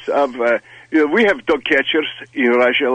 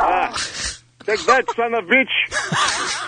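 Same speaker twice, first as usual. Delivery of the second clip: high, slow angry yell with a laugh; 10 semitones higher, 4 dB quieter, slower than usual. Acted. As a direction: yell at the dog, Russian accent. Russian character shoots dog.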